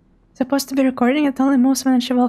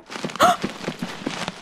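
sharp, high intake of breath; startled gasp; 7 dB quieter than usual. Real, not acted. Player startled in the dark forest, startled gasp. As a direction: gasp in fright.

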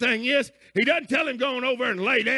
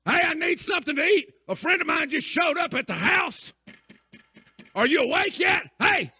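calling out; 6 semitones higher than usual. Retold or imitated. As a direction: holler up at the neighbour's window. Calling up neighbour at night.

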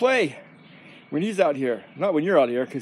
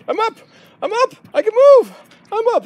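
high-pitched put-on dog; protesting dog voice; 10 dB louder than usual. Owner answers as George told to get up, high protesting voice. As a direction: squeal it as the prodded dog.

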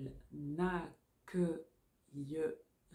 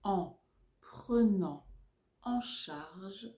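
dictating. Shown as a demonstration. Dictation chunks.

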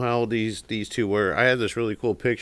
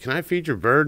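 put-on kid's voice; 8 semitones higher, 6 dB louder than usual. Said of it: "this kid's like".

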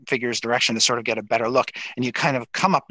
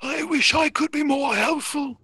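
Exothanter. Acted. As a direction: draw each phrase out in a strange creature voice.

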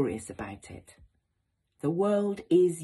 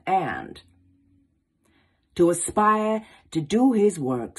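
put-on Baldwin's words in a swinging American accent, louder and building; 3 dB louder than usual. Closing the lesson with Baldwin's words on Shakespeare, in an impassioned American accent. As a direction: read it with American swing and rising oratorical force. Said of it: "I'm gonna do it in an American accent 'cause you gotta have the swing".

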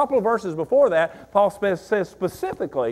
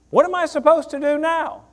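"supposed" pushed up high, the question lifted and quicker; higher urgent question; 9 semitones higher than usual. Shown as a demonstration. Pastor repeating the question to the church, higher and urgent. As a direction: ask it again, higher and more pressing.

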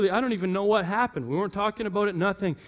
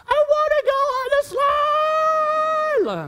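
put-on little kid shouting; 3 dB louder, slower than usual. Little son begging at the pool.

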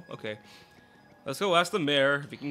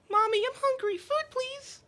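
put-on very high little kid voice; child voice. A child begs the traveler for food in a tiny high voice. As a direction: squeak it like a small hungry child.

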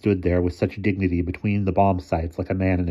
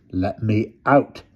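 stern; 3 semitones higher, slower than usual. A narrator's reading. Mister Thomas to the boy through the hole, stern. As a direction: draw it out as a firm order. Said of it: "Mister Thomas said sternly".

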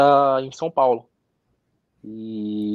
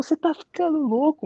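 much higher, incredulous mock doctor; doctor telling the player he is crazy, mocking imitation; imitating the doctor. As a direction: play the doctor as if the idea were absurd. Doctor voiced in story.

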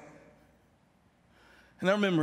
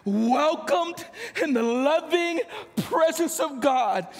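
slow, loud, near-shouted proclamation, voice catching; preaching at full force; 6 semitones higher, 5 dB louder than usual. Acted. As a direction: shout the promise slowly to the congregation.

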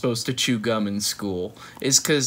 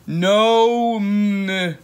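reading the scribbled no; 7 semitones higher, 8 dB louder than usual. Acted. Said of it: "there's no M in no".

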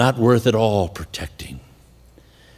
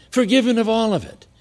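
ordinary talk

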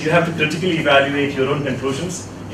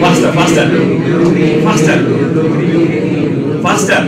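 loud coaching call of faster; urging shout; 7 dB louder than usual. Real, not acted. Teacher urging the class to speed up, loud call. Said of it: "coaching the class louder".

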